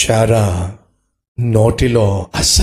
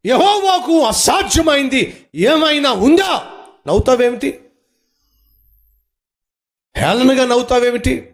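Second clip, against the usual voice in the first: preaching at full force; 10 semitones higher than usual. Acted. Pastor challenging doubters, preaching at full force. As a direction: hammer each question home.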